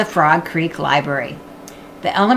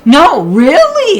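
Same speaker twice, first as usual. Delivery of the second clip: insisting; 9 semitones higher, 9 dB louder, slower than usual. Acted. Annie insisting.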